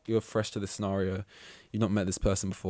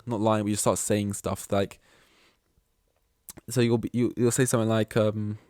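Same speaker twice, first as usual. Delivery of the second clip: ordinary talk, nothing marked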